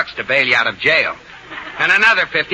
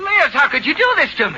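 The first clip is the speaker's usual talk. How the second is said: high, wounded protest; betrayed outcry; 11 semitones higher than usual. Acted. Husband cries out to his wife who disowned him, betrayed. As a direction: cry out at her betrayal.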